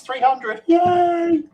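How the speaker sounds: high, loud celebratory whoop; excited cheer. Real, not acted. Host cheering the three hundredth with listeners, excited cheer. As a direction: cheer it out, voice leaping up.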